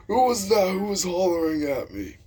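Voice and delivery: high, loud mock old-teacher yell; Mister Will. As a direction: demand answers as an irate old teacher. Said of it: "And Mister Will comes out".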